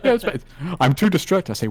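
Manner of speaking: normal talking voice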